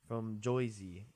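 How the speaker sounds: mock Jersey "Joyzy", oy for er; Jersey accent. Jokey Jersey pronunciation.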